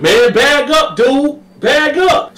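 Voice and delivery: high, loud warning; high loud bark; Carl warning. Retold or imitated. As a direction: back him off. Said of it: "Carl was like".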